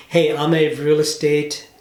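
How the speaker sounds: normal talking voice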